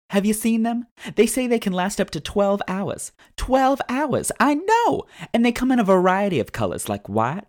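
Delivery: high, loud, bubbly ad read. Acted. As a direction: sell it like a peppy commercial. Reads a sponsor ad.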